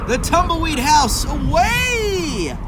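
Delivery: high, loud, very slow blowing build; hammed-up huff and puff for kids; dramatic huffing narration. A narrator's reading. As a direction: blow the words out like wind.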